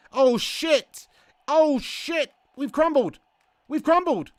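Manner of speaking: loud, high cries of dismay; alarmed outburst. Real, not acted. Streamer crying out that we've crumbled, loud and alarmed. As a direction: cry out as it all collapses. Streamer reacts to setback in game.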